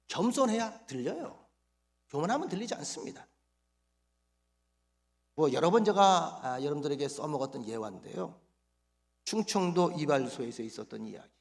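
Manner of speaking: usual speaking voice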